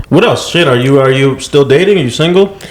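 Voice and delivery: ordinary talk, nothing marked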